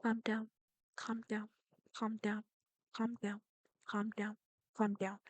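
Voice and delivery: very soft, low self-soothing repeats; calming oneself. Real, not acted. Player talking themself down after an outburst, hushed calming. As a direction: repeat it under your breath, evenly.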